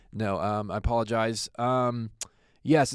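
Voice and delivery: ordinary talk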